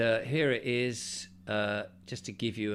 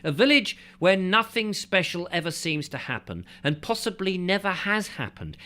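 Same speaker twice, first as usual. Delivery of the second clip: lively, raised book-reading voice; reading aloud; 3 semitones higher, 5 dB louder than usual. Acted. Reading an old travel book to viewers, storytelling read.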